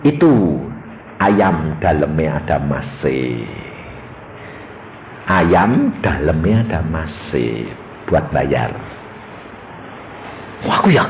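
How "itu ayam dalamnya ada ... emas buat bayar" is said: low, deliberate pronouncement; voice of God. Acted. God reveals gold inside the chicken to husband, low deliberate voice. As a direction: sink deep and intone it. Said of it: "Tuhan ngomong".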